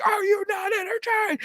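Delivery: high-pitched imitation of a team yelling; mock yell. Players taunting in the fourth quarter, high mock yell. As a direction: yell it high without much extra volume. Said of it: "they're yelling".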